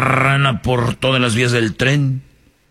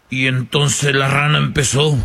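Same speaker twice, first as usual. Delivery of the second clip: ordinary talk, nothing marked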